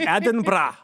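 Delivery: Edinburgh said the Scottish way, rolled r, loud; Scottish accent. Shown as a demonstration. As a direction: say Edinburgh like a Scot, Ed-in-bra. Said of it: "Do you wanna do that in a Scottish accent?".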